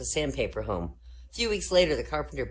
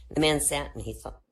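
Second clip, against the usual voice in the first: ordinary delivery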